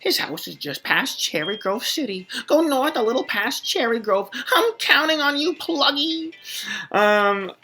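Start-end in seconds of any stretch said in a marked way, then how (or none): 0.0-6.3 game character voice. Acted. Professor urging Pluggy off on an errand, high excited character voice. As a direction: build to an excited, high send-off.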